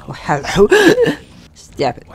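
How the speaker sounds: laughing out a high stop it; amused laughing plea. Real, not acted. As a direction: giggle through the protest. Laughing at enemy's attack.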